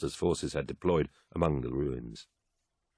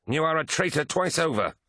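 much higher, angry accusation; Eidolon spitting anger; 11 semitones higher, 3 dB louder than usual. A narrator's reading. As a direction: hurl it as a scornful accusation. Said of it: "spat Eidolon".

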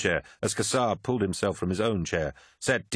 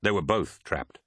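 usual speaking voice

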